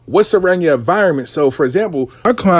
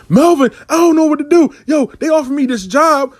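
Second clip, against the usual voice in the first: much higher, a touch louder, frantic; imitating panicked client; 10 semitones higher, 3 dB louder than usual. A teacher calling Melvin in a panic about the job offer. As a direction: mimic a flustered woman at a loss.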